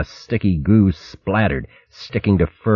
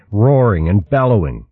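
plain reading voice

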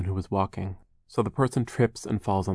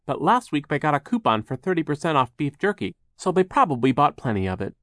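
lightly voiced Charles, higher and lively; a chatty, higher-pitched storybook helper; mild-mannered Charles; 7 semitones higher, 3 dB louder than usual. Charles brings the children jerky, lightly acted chatty voice.

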